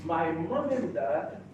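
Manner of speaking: ordinary talk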